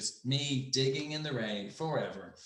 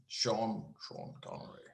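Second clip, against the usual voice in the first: low, hushed actor impression; soft, low impersonation attempt; Sean Connery; 5 semitones lower, 10 dB quieter, slower than usual. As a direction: practise a famous actor's voice under your breath. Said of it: "Can you do a Sean Connery impersonation?".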